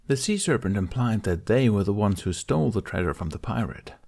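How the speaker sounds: usual speaking voice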